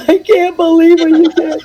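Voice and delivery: high-pitched imitation of blubbering on the phone; mock tearful wail. Co-writer retelling her tearful call with Leo, mock crying. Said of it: "I was crying my eyes out".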